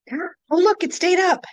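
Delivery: high, bright relieved exclamation; pleasantly surprised. Real, not acted. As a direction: react with glad surprise. Relief screen share worked.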